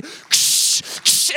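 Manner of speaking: a crackling ksh thunder sound through the teeth; hissed thunder crash; thunder noise. Shown as a demonstration. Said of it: "thunder starts to hit".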